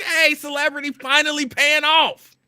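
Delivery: high, loud and slow self-satisfied cheer; gleeful. Retold or imitated. To himself at the counter, loud and gleeful. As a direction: savor it like fame finally pays. Speaker's hopeful inner thought.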